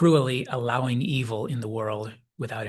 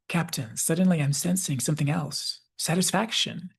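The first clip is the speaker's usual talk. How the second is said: lightly voiced empath, pitched higher; slightly dreamy higher voice, smiling; amused smile; 5 semitones higher than usual. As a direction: imitate a pleased announcement to the captain. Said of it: "she says with this amused smile".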